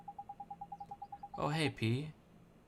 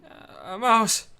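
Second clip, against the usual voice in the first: startled; 10 semitones higher, 14 dB louder, much slower than usual. Acted. Spotting a mouse.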